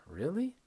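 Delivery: "Really?" breathy and high, rising; a light, high, rising "Really?". Shown as a demonstration. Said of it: "It goes down".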